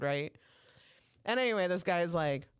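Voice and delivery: normal talking voice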